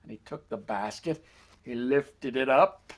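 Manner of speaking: usual speaking voice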